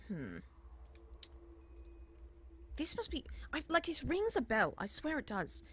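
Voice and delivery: a busy librarian looking up from her work; flustered elf scholar. Acted.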